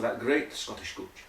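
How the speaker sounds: ordinary talk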